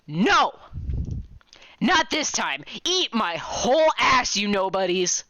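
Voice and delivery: loud, high battle taunt; defiant shout. Acted. Character taunting the enemy, shouting loud and high. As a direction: hurl it at the enemy.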